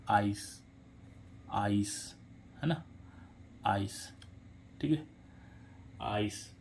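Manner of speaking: आइस said softly, with a long "ai" like "ice"; pronunciation demo. Shown as a demonstration. Teacher repeats the word for learners, softly. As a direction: repeat आइस gently, stretching the "ai". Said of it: "इसका उच्चारण क्या आ रहा है".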